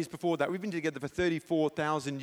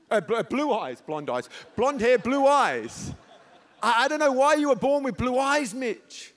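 loud, high, fervent declaration; anointed preaching voice; 6 semitones higher, 7 dB louder than usual. Retold or imitated. Preacher declares to his son in loud anointed fervour. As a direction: preach it over him, full of fire. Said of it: "then I felt the anointing kick in".

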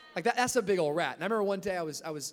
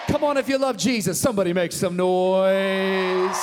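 rousing shout; 13 dB louder than usual. Real, not acted. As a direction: whip up the crowd, full voice. Preacher rousing the congregation.

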